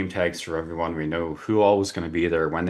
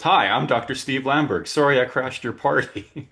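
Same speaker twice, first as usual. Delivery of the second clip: higher and louder, a lightly voiced apologetic guest with a laugh; amused guest; 7 semitones higher, 5 dB louder than usual. Guest apologising to the host for crashing, amused. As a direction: play the guest owning up with a chuckle. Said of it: "He goes".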